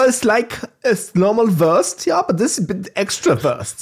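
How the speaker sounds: jokey, bad German accent. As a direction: explain it in a put-on German accent. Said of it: "I do that German accent".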